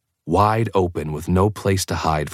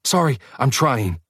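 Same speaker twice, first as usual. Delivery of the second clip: panicked; 7 semitones higher than usual. A narrator's reading. Alice panicked apology.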